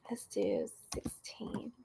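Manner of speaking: soft unvoiced whisper. Real, not acted. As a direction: breathe the words quietly. Quietly picks cards on stream.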